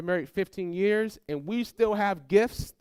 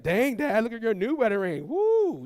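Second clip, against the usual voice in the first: higher voice rising into a whoop; impressed excitement; 4 semitones higher than usual. Retold or imitated. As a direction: play an impressed kid cheering dad. Child impressed by dad's gift.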